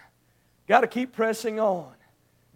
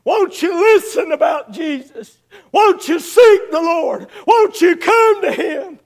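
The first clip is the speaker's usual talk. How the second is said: preacher's raised, urgent plea; pleading and begging; 6 semitones higher, 6 dB louder than usual. Retold or imitated. Acting out parents begging children to seek Jesus, pleading. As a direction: implore them, pitch climbing with each appeal. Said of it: "have pleaded and pleaded and begged".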